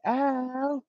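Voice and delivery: a quick high yelp of ow; high club-style ow yelp. Retold or imitated. Producer recalling the hook, a high yelped ow. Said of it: "I want the ladies to say".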